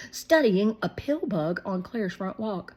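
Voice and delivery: usual speaking voice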